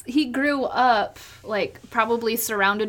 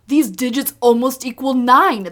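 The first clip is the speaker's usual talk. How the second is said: loud, lightly put-on character quote; playful imitation of the superstitious boxer, louder; lightly voiced movie boxer; 3 semitones higher, 7 dB louder than usual. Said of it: "he's like".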